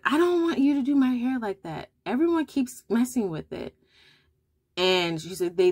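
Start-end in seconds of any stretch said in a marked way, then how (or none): 0.0-3.7 imitating a little girl, pitched higher; a child complaining about her hair, voiced higher; daughter's voice. Mother imitates her upset daughter, higher child voice.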